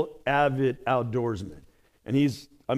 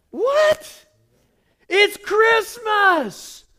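very high, slow, loud disbelief; can't believe the indifference; loud high astonished protest; incredulous; 5 dB louder, much slower than usual. Real, not acted. Incredulous at reserved grandparents.

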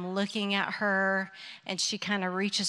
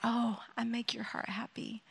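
soft whisper to herself; hushed self-reassurance; whispered reminder; 2 semitones higher, 8 dB quieter than usual. Retold or imitated. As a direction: whisper it as a private truth. Reminding herself of God's love.

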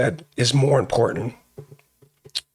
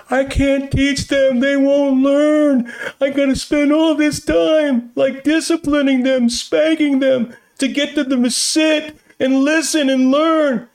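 higher, mock tearful whine of a worn-out mother; imitating crying wife; 9 semitones higher than usual. Pastor retells wife's crying phone call, higher mock tearful whine. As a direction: play his wife near tears, complaining. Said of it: "And she's boo-hooing".

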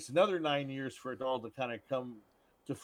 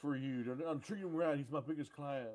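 hammed-up movie voice; 19 dB louder than usual. Acting out movie scene, high squeal.

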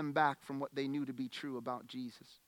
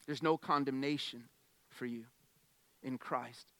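softer, hushed preaching voice; tender pastoral hush; soft reassurance; 5 semitones lower, 7 dB quieter than usual. Acted. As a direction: lean in and reassure gently.

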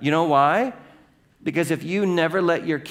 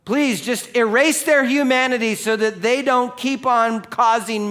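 whiny demanding prayer; 7 semitones higher, 4 dB louder than usual. Retold or imitated. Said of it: "I want God to".

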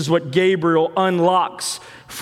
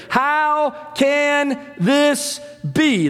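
higher, slow and bewildered; Mary's bewildered question; 8 semitones higher, much slower than usual. Retold or imitated. Mary answering the angel, higher and slowly bewildered. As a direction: let the disbelief hang. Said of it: "That's Mary's response to the angel".

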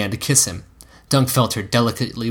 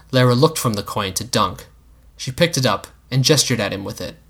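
plain reading voice

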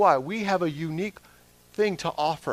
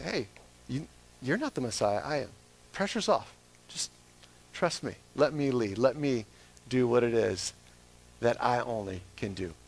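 soft, reassuring voice of the Lord; lightly voiced, tender calming; gentle reassurance; 4 semitones lower, 6 dB quieter than usual. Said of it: "The Lord's like".

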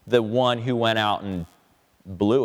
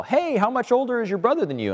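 higher, put-on nosy voice; mock curious stranger; 10 semitones higher than usual. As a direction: play a chatty stranger asking. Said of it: "everybody who's ever met us goes all".

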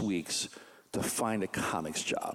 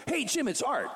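chatty phone voice, pitched up; voicing Art on the phone; 9 semitones higher than usual. Art calls his friend Jim, high and chummy.

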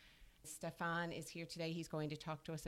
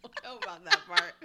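high, loud words through laughter; laughing; 12 semitones higher, 10 dB louder than usual. Real, not acted. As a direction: protest while cracking up. Cracks up at co-host's accent.

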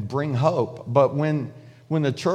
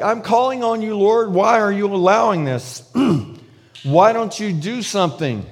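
raised, pleading cry to the Lord; 5 semitones higher, 4 dB louder than usual. Retold or imitated. Prophet Habakkuk crying out to the Lord, raised plea. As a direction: cry out to God, higher and louder. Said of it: "he's crying out to the Lord".

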